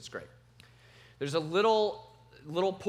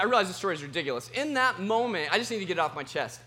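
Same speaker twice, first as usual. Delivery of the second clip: ordinary delivery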